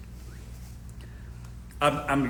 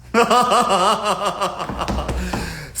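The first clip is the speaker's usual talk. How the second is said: laughing; 2 semitones higher, 4 dB louder than usual. Real, not acted. Laughs at her own joke.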